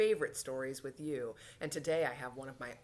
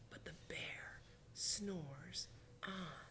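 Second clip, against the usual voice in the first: slow, soft whisper; hushed refrain; 12 dB quieter, much slower than usual. A narrator's reading. Teacher reads of the sleeping bear, slow hush.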